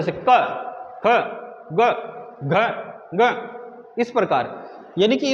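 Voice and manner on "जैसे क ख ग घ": क, ख, ग, घ each said short and sharp from the throat; clipped throat sounds क, ख, ग, घ; jerky letter demo. Shown as a demonstration. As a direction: snap out क, ख, ग, घ one by one. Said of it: "इसको झटके से बोला जाता है".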